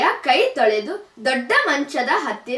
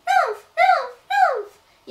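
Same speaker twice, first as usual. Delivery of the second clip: squeaky little bear, much higher; little bear call. A narrator's reading. Baby bear calls out coming home, high squeaky cub voice. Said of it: "ಎಂದು ಕೂಗಿತು".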